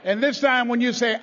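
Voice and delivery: ordinary delivery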